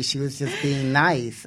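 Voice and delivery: ordinary delivery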